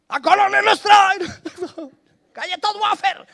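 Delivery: very high, loud hawker yell; street vendor shout. Retold or imitated. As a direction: belt it like a street seller. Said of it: "el ímpetu de esta vendedora en la calle". (grito de vendedora callejera)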